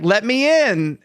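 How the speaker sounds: high-pitched retold plea to get in; mock-urgent demand at a closed door; calling through door. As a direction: call out as if locked out. Calling to be let in.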